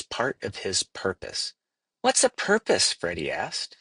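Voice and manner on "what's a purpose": put-on high, wondering child voice; curious young leaf. A narrator's reading. Young leaf Freddy asks wise Daniel, high and curious. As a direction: ask it up high like a small child.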